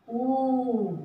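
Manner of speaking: ऊ drawn out long with a little extra force; long stressed oo. Shown as a demonstration. Teacher repeats the long ऊ for children, drawn out. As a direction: stretch ऊ and lean into it. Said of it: "थोड़ा सा जोर लगाकर पढ़ते हैं".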